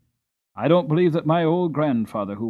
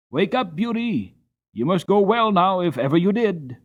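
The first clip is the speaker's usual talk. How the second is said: loud urgent call, higher; 8 semitones higher, 6 dB louder than usual. A narrator's reading. John wakes Beauty in the night, calling out loud. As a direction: rouse the horse in a hurry. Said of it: "calling out".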